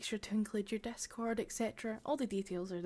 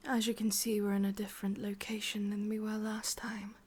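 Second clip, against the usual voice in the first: whispering witch. Acted. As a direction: breathe it close to the ear, sweetly menacing. ASMR witch roleplay.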